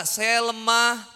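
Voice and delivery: slow, drawn-out mock self-pity; whiny complaining. Ustaz mimicking a student's excuses, slow whiny self-pity. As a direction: drag each excuse out like a tired student. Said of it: "Kita tuh selalu membesarkan rasa".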